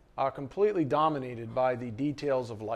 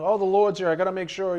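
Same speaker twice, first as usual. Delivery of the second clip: hurried, louder fussing hostess; Martha fussing; 5 semitones higher, 6 dB louder, faster than usual. Pretend. Preacher voicing Martha fretting over guests. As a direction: fret like a busy hostess. Martha voiced rushing around.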